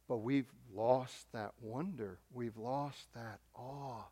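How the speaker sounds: soft and very slow, weighed down; wistful lament. Real, not acted. Preacher mourning the church's lost wonder, slow and soft. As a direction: let the loss sink in quietly.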